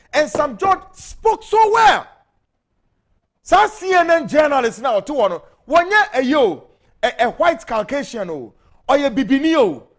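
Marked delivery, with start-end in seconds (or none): 0.1-5.0 excited praise. Real, not acted. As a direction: cry it out with pride. Praising speaker passionately.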